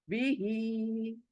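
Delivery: बिही with ही held long and soft; long final hee. Shown as a demonstration. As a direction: stretch the ही at the end of बिही. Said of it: "ही को खींच रही हूँ". (बिही में ही को खींचकर)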